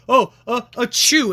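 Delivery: a hammed-up fake allergy sneeze; mock sneeze. Friend mocking a sore loser faking a sneeze, hammed-up. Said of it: "you're like".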